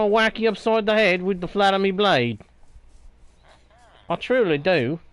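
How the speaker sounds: lightly put-on pirate accent, growling at the villager; a hint of a pirate accent, mostly his own voice; mock pirate accent. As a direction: play-act a pirate accent for fun. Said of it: "Sorry for the accent shift".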